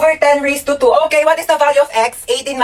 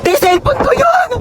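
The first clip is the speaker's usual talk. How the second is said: shocked; 13 semitones higher, slower than usual. Acted. Shocked at her own phone.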